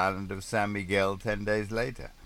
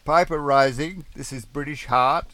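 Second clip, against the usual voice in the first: high, loud radio hail with a rising end; radio call sign; 8 semitones higher, 9 dB louder than usual. Acted. Sailor calling Piper Rising on the radio, high hail. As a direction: call out the call sign over the airwaves.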